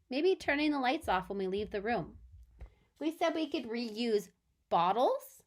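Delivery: ordinary talk, nothing marked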